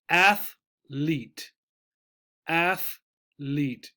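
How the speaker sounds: athlete in two syllables, ATH-lete; correct athlete. Shown as a demonstration. Teacher modelling the word for students. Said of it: "The pronunciation, just like the spelling".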